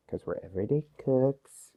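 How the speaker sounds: breathy whispered aside. Real, not acted. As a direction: whisper the catchphrase to the camera. Running catchphrase whispered aside.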